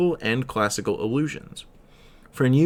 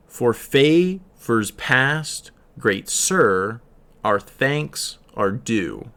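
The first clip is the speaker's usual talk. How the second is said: very slow, five beats of "For favors past, great sir, our thanks are due" stressed; metrical stress demo; much slower than usual. Shown as a demonstration. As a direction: read it out beat by beat in iambic pentameter. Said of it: "is how it's meant to be read".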